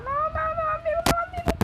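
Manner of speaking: high desperate pleading yell; panicked shout. Real, not acted. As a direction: cry out no in panic. Losing a fight in game.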